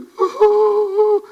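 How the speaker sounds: high-pitched laughing break; real laugh mid-sermon; burst of laughter. Real, not acted. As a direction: laugh out at the absurd scene. Laughing at mocked preacher scene.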